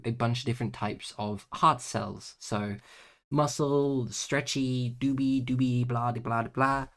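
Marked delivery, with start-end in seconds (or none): none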